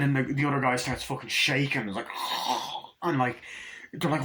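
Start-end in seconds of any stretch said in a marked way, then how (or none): 2.1-3.0 breathy voiceless mock gasp; drawn-out gasp of horror; imitated gasp. Other guy starts shaking, imitated gasp.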